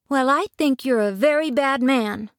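high-pitched, indignant rebuke; scolding girl. A narrator's reading. Dorothy rebukes the wizard, scolding.

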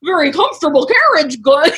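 high, giggly oblivious voice; laughing Edmund impression. Mocking Edmund, she laughs through his carriage line. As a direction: play him cozy and clueless. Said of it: "he's like".

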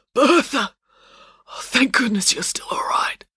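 weak, high, breath-starved greeting; gasping with relief, short of breath; laboured breaths. Acted. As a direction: struggle to get the words out. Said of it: "struggled to get the words out between laboured breaths".

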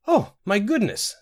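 high, drawn-out flustered surprise. A narrator's reading. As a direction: flutter with surprise, pitched well up. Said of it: "all afluster".

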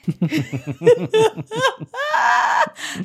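a high, loud fit of laughter; loud high shrieking laugh. Real, not acted. After botching French, loud shrieking laugh. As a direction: crack up loudly.

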